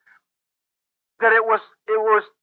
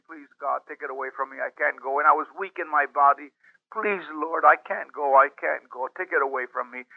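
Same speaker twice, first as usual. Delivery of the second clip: much softer and lower, a worn-out plea; retold tearful pleading; 8 semitones lower, 6 dB quieter than usual. Young man pleading with God while weeping, much softer. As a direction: beg God faintly, with no strength left. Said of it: "I was crying profusely".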